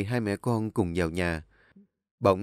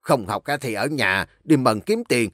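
louder, rising angry sneer; mock the idea of schooling, loud; drunk scornful father; 5 semitones higher, 6 dB louder than usual. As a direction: drunk father shouting that learning is useless.

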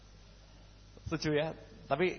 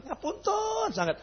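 exaggerated apology; 14 semitones higher, 4 dB quieter than usual. Exaggerated politeness.

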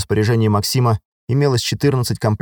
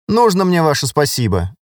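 lightly scornful, pitched up; snorting dismissal; 7 semitones higher than usual. A narrator's reading. Rebuffed sergeant answers the lieutenant, mildly huffy. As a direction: brush off the thanks with a mild huff. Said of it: "фыркнула сержант".

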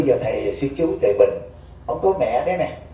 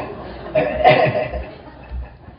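hearty laugh. Real, not acted. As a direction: laugh freely at the punchline. Monk laughs at his own story.

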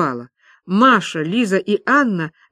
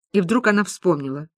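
plain reading voice

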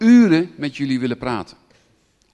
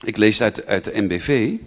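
usual speaking voice

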